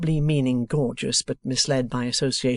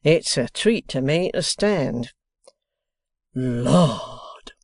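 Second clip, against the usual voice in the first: put-on slow, relishing old man, savouring each word; great enjoyment; much slower than usual. A narrator's reading. Boffin delighting in the bookstall, slow and relishing. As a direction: Boffin delighting in his seat. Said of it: "in a tone of great enjoyment".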